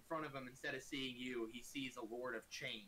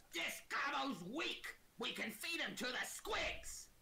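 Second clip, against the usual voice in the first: high, loud goblin jeer, drawn out; 13 semitones higher, 4 dB louder, slower than usual. Acted. Goblin sizing up a foe for his mates, high goblin voice. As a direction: call it out as a high-pitched little goblin. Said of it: "looks over and goes".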